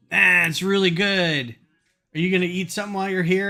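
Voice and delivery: enthused cashier. Retold or imitated. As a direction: pump up the customer, gushing. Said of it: "he was really enthused".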